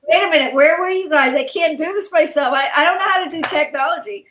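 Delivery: flustered overwhelmed complaint; retold upset outburst. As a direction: replay the frantic moment of being left alone with the tech. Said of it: "And I was upset".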